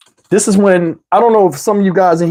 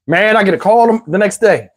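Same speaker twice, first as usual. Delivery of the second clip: usual speaking voice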